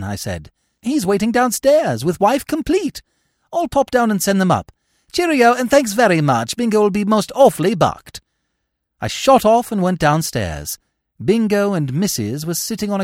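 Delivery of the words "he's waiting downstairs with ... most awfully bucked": put-on high, chirpy young gentleman; breezy young Bertie. A narrator's reading. Bertie reporting to the uncle, high and breezy. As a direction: pass on good news brightly, pitched high.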